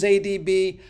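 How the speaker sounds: ordinary talk, nothing marked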